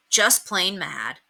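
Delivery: usual speaking voice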